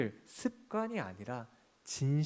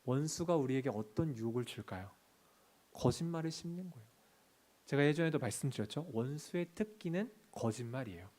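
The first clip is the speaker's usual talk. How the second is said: usual speaking voice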